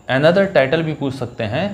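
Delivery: ordinary delivery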